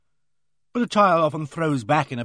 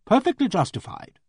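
mildly angry, pitched much higher; indignant retort with a raised pitch; 9 semitones higher than usual. A narrator's reading. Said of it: "in an angry voice".

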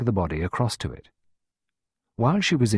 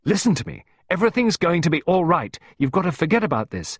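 put-on high, forceful shout at a child; insistent, raised voice, building; 9 semitones higher, 4 dB louder than usual. A narrator's reading. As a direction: cut through the crying with urgent orders. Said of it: "Clarissa shouted at her".

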